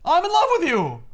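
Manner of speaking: high, fast, loud blurting; over-the-top Preston. Retold or imitated. Preston gushes his love to Lady Clay, high and loud. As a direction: ramp it up until he confesses love. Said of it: "Take it down a notch".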